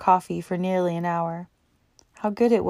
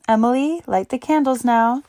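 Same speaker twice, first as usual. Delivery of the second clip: raised, higher call to someone away; 6 semitones higher, 5 dB louder than usual. A narrator's reading. Helen calls Emily to light the candles, raised call. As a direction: call her in from the next room.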